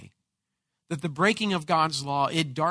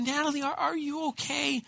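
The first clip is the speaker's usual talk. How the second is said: soft worried dad checking on his crying daughter; gentle concern; 9 semitones higher, 5 dB quieter than usual. Retold or imitated. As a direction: ask tenderly, kneeling close. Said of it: "I said".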